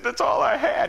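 loud, high imitation of crying; tearful man. Man with empty pockets to pastor, imitated loud wail. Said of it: "he was just a-crying".

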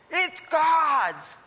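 emphatic exclamation. Retold or imitated. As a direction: burst out with the triumphant point. Climax of his retold witnessing.

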